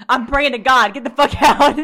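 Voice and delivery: high, loud, laughing through the words; cracking up while reliving the panic. Retold or imitated. Laughing while telling a friend about panicking.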